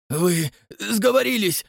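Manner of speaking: hoarse mutter. A narrator's reading. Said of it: "хрипло пробормотал Растопчин-старший".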